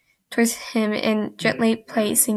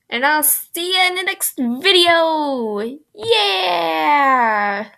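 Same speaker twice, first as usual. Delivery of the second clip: cheerful sign-off; 6 semitones higher, much slower than usual. Real, not acted.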